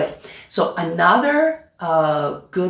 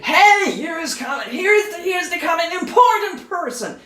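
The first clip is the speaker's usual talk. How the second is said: high-pitched, hammed-up yelled announcement; screaming announcement; 10 semitones higher than usual. Shown as a demonstration. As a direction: herald a VIP at a mock scream, pitched way up.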